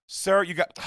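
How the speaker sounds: high, clipped scolding start; mock stern clerk. Mocking hotel lady at door.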